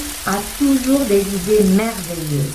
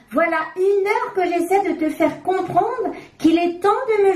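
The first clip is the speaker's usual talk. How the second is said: much higher, fed-up nagging voice; insist, losing patience; exasperated diaper; 7 semitones higher than usual. A narrator's reading. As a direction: diaper scolds Coco, high and exasperated.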